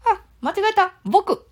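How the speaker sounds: put-on very high squeaky child voice; high boy puppet butting in; Ken-chan puppet voice. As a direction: pipe up high like a cheeky little boy. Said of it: "ケンちゃん。".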